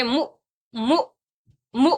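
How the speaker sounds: مو cut off with a glottal jolt; jerked hamzah. Shown as a demonstration. Teacher models for students, short jerked مو. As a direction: stop each مو abruptly on the hamzah. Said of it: "جھٹکا دے کر پڑھیں گے".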